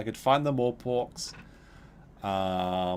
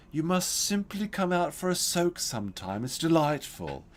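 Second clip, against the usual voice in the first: put-on flamboyant old neighbor; 6 semitones higher than usual. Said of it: "He said these words exactly".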